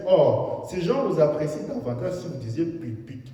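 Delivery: loud, high fervent sermon delivery; full-force preaching. Acted. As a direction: preach it out to the hall. Preacher retells critic scene.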